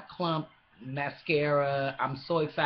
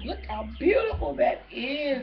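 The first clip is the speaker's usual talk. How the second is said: high, choked-up joy over the bag; almost crying with happiness; tearful excitement; 7 semitones higher than usual. Real, not acted. Showing her husband's gift, near tears with joy. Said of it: "I'm in tears right now".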